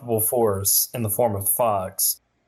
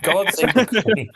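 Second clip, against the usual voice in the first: much higher, put-on patriotic cry; mock-British exclamation; 9 semitones higher, 4 dB louder than usual. Insisting on it after admitting God didn't, mock-British outcry. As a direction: proclaim it as a hammed-up Brit.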